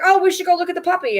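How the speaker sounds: high, fast, breezy mock suggestion; mock breezy self. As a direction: play innocent, just a casual look. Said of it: "I was like".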